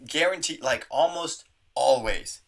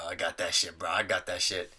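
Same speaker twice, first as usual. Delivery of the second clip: ordinary delivery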